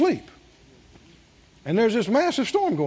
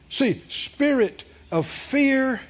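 usual speaking voice

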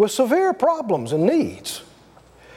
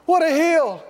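higher, groaning at the hill; mock-dismayed groan; weary complaining; 6 semitones higher than usual. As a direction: moan like a tired man facing a climb. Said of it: "he goes".

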